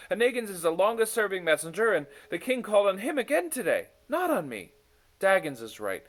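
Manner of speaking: the words tumble out in self-pity; Smuggins, spiralling doubt. A narrator's reading. Smuggins frets over the king passing him over, fast and high. Smuggins' anxious inner monologue.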